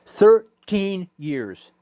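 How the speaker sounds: slow, weighty stress on thirteen; slow emphasis. Real, not acted. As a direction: stretch each word to make it weigh. Stressing long friendship.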